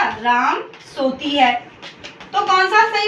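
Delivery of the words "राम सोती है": says राम सोती है slowly, ending on a falling है; slow wrong example. Shown as a demonstration. Teacher reading the wrong example to the class slowly. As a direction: read out slowly as the faulty sentence. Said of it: "मैंने दूसरा लिख दिया".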